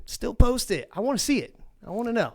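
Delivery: high, eager push to viewers; high-pitched plea to viewers to post their ports; excited urging. Real, not acted. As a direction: rope the viewers in, bright and keen.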